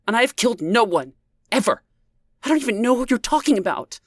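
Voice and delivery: high, fast furious denial; angry. A narrator's reading. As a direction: spit it out in rage. Leah seething with rage.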